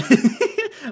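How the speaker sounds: loud, high burst of laughter; sudden high cackle. Real, not acted. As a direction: crack up at your own bad impression. Laughs at own bad accent.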